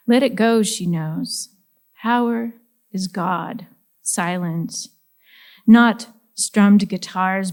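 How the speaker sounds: slow, weighted poetry recital, key words hit hard; poem reading. Acted. Poet reading Division to a live audience, measured and emphatic recital. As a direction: declaim it line by line with deliberate pauses. Reads her poem aloud.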